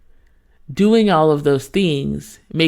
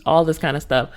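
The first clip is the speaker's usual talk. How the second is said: usual speaking voice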